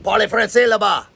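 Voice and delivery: half-earnest retold scolding; mock yelling. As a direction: bark French like an annoyed superior, playfully. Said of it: "I was always getting yelled at".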